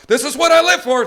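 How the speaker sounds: loud, high burst of puppy joy; happy excited dog. Acted. Trainer voicing the dog chasing his bird, happy excited shout. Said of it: "when he's all happy about".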